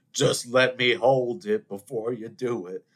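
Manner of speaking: slow, put-on pleading voice; pleading Bill Paxton character. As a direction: beg for the diamond, drawn out. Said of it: "and he goes".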